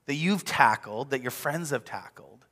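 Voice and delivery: ordinary talk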